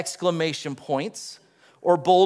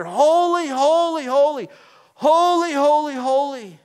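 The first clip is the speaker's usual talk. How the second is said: loud, high ringing cry of holy; angels shouting; 8 semitones higher, 6 dB louder than usual. Acted. Seraphim crying out to each other, loud and high. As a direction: call it out like seraphim across the temple.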